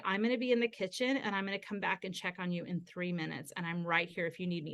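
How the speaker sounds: quiet, unhurried comfort; calm reassurance. Retold or imitated. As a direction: let the child know you are near. Reassuring son who wants space.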